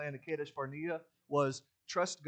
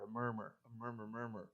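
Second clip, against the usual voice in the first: softened, lower murmur trailing away; conspiratorial murmur; 2 semitones lower, 8 dB quieter than usual. Shown as a demonstration. Preacher showing rebellious thoughts coming out, quiet conspiratorial murmur. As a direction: drop the voice as if plotting, without whispering. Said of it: "a conspiratorial whisper".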